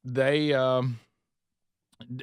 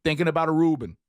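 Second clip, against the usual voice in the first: higher-pitched New York diner; hammed-up New Yorker; mock New York accent; 4 semitones higher, 4 dB louder than usual. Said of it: "ordering my food that way".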